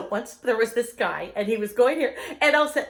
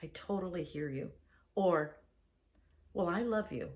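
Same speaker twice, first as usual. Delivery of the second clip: tender reassurance; 5 semitones lower, 7 dB quieter than usual. Retold or imitated. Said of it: "You need to say".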